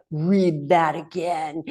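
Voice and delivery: slow, condescending "Read that again"; mock the snide internet tone, slowly; smug. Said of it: "that sort of".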